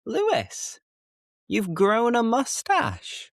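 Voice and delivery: high-pitched mock surprise; imagined listener. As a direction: play a startled viewer noticing the mustache. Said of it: "you're probably thinking".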